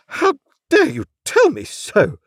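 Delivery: high, loud affronted mother; haughty, outraged high-pitched scolding; indignant retort. A narrator's reading. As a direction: snap back in outrage, word by word. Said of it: "retorted Missus Pocket".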